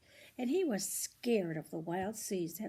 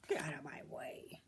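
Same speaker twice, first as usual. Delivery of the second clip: low whisper with a lightly voiced grumpy tug; whispered gruff order; 6 semitones lower, 10 dB quieter than usual. Grumpy big tugboat telling the little one to move, whispered. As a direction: hiss the order under your breath. Tugboat McGillicuddy snaps at Toot.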